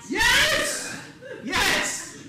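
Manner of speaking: high, loud exclaimed confirmation; real exuberant shouted answer; emphatic yes. Real, not acted. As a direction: insist on it, laughing. Answers audience member's doubt.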